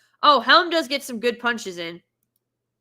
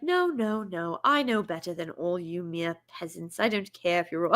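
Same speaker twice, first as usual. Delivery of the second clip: snooty princess; 5 semitones lower, 6 dB quieter than usual. Retold or imitated. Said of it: "And she's like".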